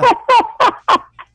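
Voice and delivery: loud high laugh. Real, not acted. Laughs at puppet's joke.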